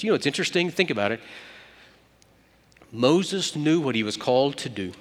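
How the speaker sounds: ordinary delivery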